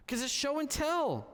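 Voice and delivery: put-on a child confessing fear at bedtime; little daughter. Daughter voiced in bedtime story.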